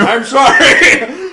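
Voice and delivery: high, drawn-out shouted apology; loud protest. Real, not acted. Caught chewing by co-host, loud laughing protest. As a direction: yell the apology through laughter. Teased for silence while chewing pizza.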